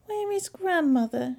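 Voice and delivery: put-on high, questioning child voice; high storybook question; little girl asking. A narrator's reading. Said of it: "she asked".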